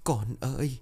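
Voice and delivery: a sudden crying call, high and hushed; bursting into tears. A narrator's reading. Mother breaks down crying for her dead daughter, anguished cry. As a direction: break down calling out for her child. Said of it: "bà Huệ bật khóc".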